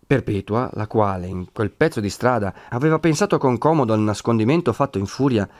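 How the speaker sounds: usual speaking voice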